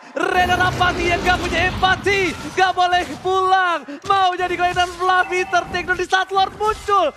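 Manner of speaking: high, loud hype commentary; excited shouting. Real, not acted. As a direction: roar the play-by-play. Play-by-play of a clutch kill.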